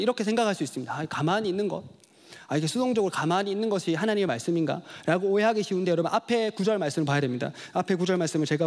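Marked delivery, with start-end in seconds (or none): none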